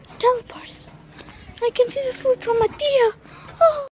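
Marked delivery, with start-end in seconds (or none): 0.1-0.7 unvoiced whisper. Real, not acted. Player whispering to viewers about teleporting, whisper. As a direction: breathe the word like a secret.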